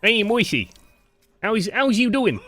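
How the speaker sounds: high, hammed-up New York gangster accent. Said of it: "like, gangster movie".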